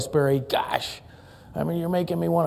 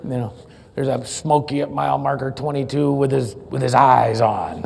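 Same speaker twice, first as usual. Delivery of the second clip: low CB trucker call; trucker cb radio; 6 semitones lower than usual. Retold or imitated. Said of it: "I remember C B radios".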